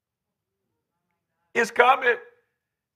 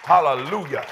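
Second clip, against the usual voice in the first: normal talking voice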